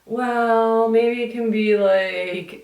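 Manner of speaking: high held tones, mock pondering; tentative doctor. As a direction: imitate a doctor musing, high and drawn-out. Voices doctor guessing at cause.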